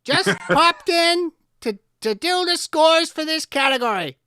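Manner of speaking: a high, loud Lynch-style cow popping in; David Lynch as a Scottish cow, very high. Pretend. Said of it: "add in David Lynch to the accent".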